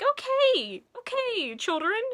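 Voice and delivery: put-on high, sing-song voice for small children; kids' show host. To viewers, she mimics a kids' show host, sing-song and high. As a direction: talk like a preschool TV host. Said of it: "the Miss Rachel of music channels".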